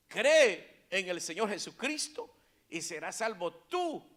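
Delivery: loud proclamation to the congregation; emphatic preaching. Acted. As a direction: launch hard on the first word.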